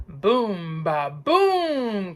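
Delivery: a far-off rolling boom, ba, boom; thunder boom. A narrator's reading. Narrator to child listeners, booming thunder sounds. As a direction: roll the thunder out.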